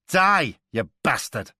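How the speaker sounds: loud spitting shout, pitch jumping high on die; shouted curse. A narrator's reading. Man pinned on the ground shouts at his attacker, loud and hateful. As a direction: spit the words up into his face. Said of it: "he said".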